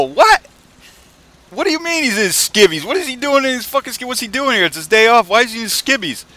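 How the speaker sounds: high, shouted mock confusion; loud baffled questioning. Crew questions the boss about Tony, mock baffled yelling. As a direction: pile up the questions in exaggerated disbelief.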